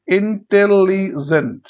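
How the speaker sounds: Intelligent slowly in four parts; syllable by syllable. Shown as a demonstration. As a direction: break intelligent into in-tel-li-jent. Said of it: "এটাকে ভাঙলে কি হবে".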